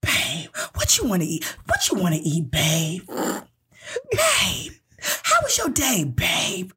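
slow, raspy mock sweet-talk; hammed-up husky pet-name voice; over-familiar partner. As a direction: imitate a date cooing like a longtime boyfriend. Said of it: "like we've been together for ten years".

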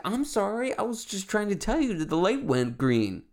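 high-pitched crybaby whine; mock-crying apology. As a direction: taunt them with a fake weepy sorry. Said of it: "make the face of somebody who's, like, crying".

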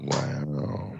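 low, quiet unimpressed wow; deadpan it, clearly unimpressed; sarcastic wow. Real, not acted. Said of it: "That was a sarcastic wow".

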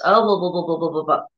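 low, run-together mock babble; wordy babble. Preacher imitates people using too many words, mock babble. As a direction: rattle off meaningless filler in a low tone. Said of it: "using a lot of words".

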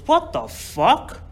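high, very slow disbelief; drawn-out acted outrage; appalled uncle. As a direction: stretch out the shocked uncle's question. Uncle walks in on mess.

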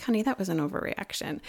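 soft gentle correction. Retold or imitated. Wife softly telling husband he overreacted, gentle. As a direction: say it softly so it lands easy. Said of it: "and I said it just like this".

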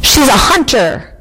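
understated lion's roar, a little emphatic; mild roar. A narrator's reading. Lion asserting who God is, mild roar. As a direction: give a restrained storybook roar. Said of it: "roared the lion".

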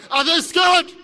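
high, forceful challenge to the crowd; rallying shout. Real, not acted. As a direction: fire the question out for a roared answer.

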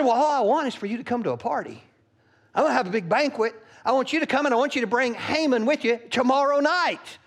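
fast, high, bubbly invite; eager invitation. Acted. Queen eagerly inviting the king, fast and high. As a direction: rush it out brightly.

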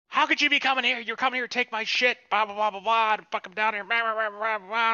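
high-pitched imitation of a nutty ranter; crazy woman. Crazed woman accusing the man of taking her stuff, hammed-up high rant. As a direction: babble a mock accusing tirade up high. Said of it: "and she's nuts".

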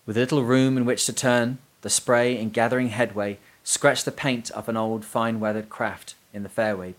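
ordinary delivery

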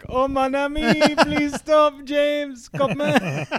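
high, loud, pleading mock French teacher. Teacher begging a raging pupil to stop, mock French pleading. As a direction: plead shrilly in a hammed-up French accent, dragging the end. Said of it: "Some poor French teacher like".

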